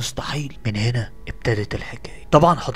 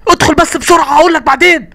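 urgent; 13 dB louder than usual. Acted. Urgent rush inside.

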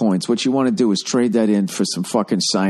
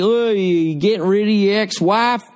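suspicious contractor; 7 semitones higher than usual. Retold or imitated. Contractor voiced again.

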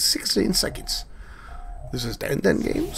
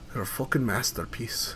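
softer, lower, almost to himself; quiet aside; 4 semitones lower, 7 dB quieter than usual. Acted. Gamer admiring vehicles, hushed awed aside.

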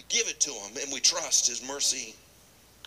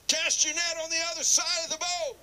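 calling out; 12 semitones higher than usual. Acted. Stranger on shore calls out.

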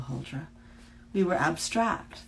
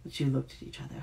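usual speaking voice

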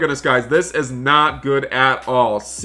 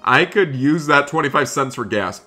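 ordinary delivery

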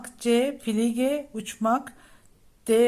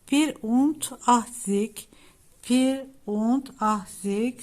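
slow careful pronunciation; slower than usual. Shown as a demonstration. Teacher models German number.

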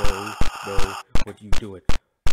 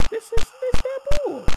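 squeaky whiny complaint. Real, not acted. Frustrated, squeaky high voice.

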